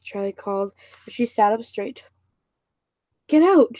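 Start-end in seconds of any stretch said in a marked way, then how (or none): 3.3-3.8 higher, louder shout to get out; startled girl yelling at John to leave. A narrator's reading. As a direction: yell him out of the room. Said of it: "she cried".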